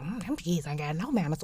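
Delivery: low, quiet tut-tut of a judging elder; lightly voiced judging church lady; disapproving elder. Elder scolding about my kids, mild disapproving voice. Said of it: "they're gonna be like".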